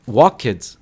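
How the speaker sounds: "walked" slowly as two beats, WALK-ed; drawn-out "walked" with an extra syllable; Brazilian-style reading. Shown as a demonstration. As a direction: sound out the e in "walked". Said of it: "if I wrote walk".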